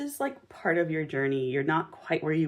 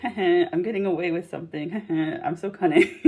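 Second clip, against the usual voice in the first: playing a cunning schemer, chuckling; mock sneaky giggles between the boasts; weird sly laughter; 5 dB louder than usual. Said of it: "sorry for that weird laughter that I did".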